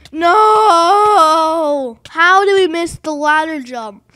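drawn-out gamer yell of dismay; anguished shout. Real, not acted. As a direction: shout in disbelief at blowing an easy jump. Failing an easy jump.

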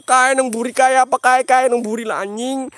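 high, loud alarmed shouting; scared shouting. Real, not acted. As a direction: shout a frightened warning. Scared, pointing at something behind. (teriak ketakutan)

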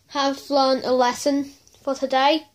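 usual speaking voice

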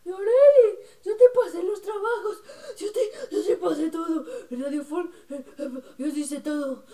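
loud, high mock whining; Daniela. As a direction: imitate a friend wailing in complaint. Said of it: "también luego dice".

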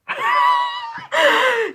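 long, high-pitched laugh; amused laugh. Real, not acted. Viewer amused by DJ's LeBron bit, high laughter.

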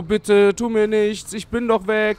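laut und höher, verzweifelt flehen; panisch flehend. Real, not acted. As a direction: fleh das Monster voller Angst an. Terrified pleading at monster. (verzweifelt laut das Monster anflehen)

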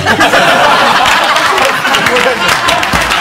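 high surprised laugh; burst of laughter. Real, not acted. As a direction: laugh out in disbelief. 驚きの笑い. (驚いて高く笑う)

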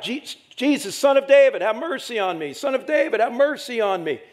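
high, loud pleading cry to Jesus; a blind beggar cries out to Jesus, pleading loudly; crying out for mercy. Acted. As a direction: call out desperately from the roadside. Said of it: "he starts crying out".

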